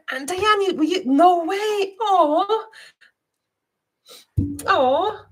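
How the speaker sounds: gasp, then a high, moved "no way"; overwhelmed by a viewer's gift, voice lifting; touched surprise. Real, not acted. Host thanking Diane for a gift, gasping and moved. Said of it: "I'm getting emotional".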